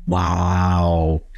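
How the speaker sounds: low, drawn-out wow; stunned wow. Retold or imitated. Actor facing an unblinking co-star's stare, stunned wow. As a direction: let the word out low and long, taken aback.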